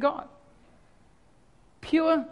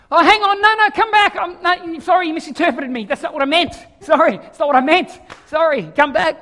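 panicked modern pastor; 3 semitones higher, 7 dB louder than usual. Acted. Pastor begging walked-out congregation to return, mock panic. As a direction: plead hurriedly for them to come back. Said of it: "you know what I would want to do?".

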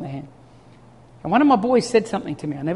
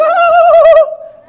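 put-on loud high kid giggle; a hidden child cracking up; 14 dB louder than usual. Kids hiding behind tree, high giggle.

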